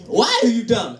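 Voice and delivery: mock African scolding; African accent. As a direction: get into character with an African accent. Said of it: "It gets me into the character".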